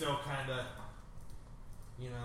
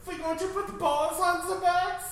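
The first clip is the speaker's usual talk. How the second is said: high, slow, laughing fake German; mock German accent; 7 dB louder than usual. Friend asks about balls on bags, high German laugh. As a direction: squeak it out slowly through laughter.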